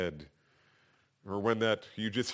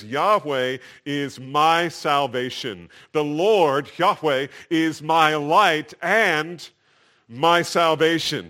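slow, louder scriptural proclamation; declaring; 4 semitones higher, 9 dB louder, slower than usual. Retold or imitated. Preacher quotes David on his salvation, declaring. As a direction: declare it firmly, word by word. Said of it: "he declares".

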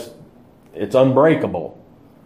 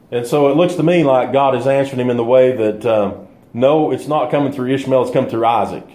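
ordinary delivery

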